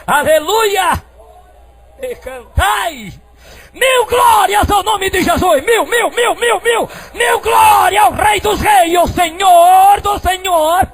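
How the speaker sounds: shouted praise, high and drawn out; full-force preaching. Acted. Preacher calling the church to praise, high slow shout. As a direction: cry out glory with long, held words.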